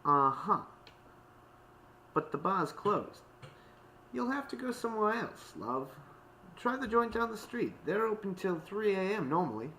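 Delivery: high-pitched barmaid brush-off; unconvinced barmaid turning someone away; dismissive barmaid. A narrator's reading. Barmaid turns Octavia away.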